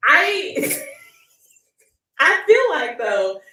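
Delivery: high, slow giggly words; laughing. Real, not acted. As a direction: laugh through the line. Laughing about late uploads.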